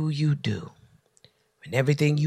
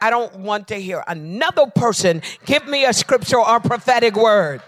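fed up; 7 semitones higher, 7 dB louder than usual. Retold or imitated. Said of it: "I was like".

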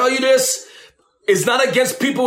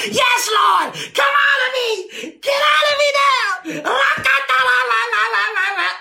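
much higher, hammed-up screaming; mock zealous shout; 12 semitones higher than usual. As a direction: parody the overwrought delivered person. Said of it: "I'm not talking about the".

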